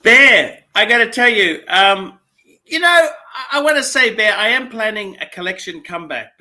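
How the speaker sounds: high, booming declaration easing down; loud and emphatic, settling as it goes; loud announcement. Real, not acted. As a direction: call out big news to a friend. Host loudly announces his comeback.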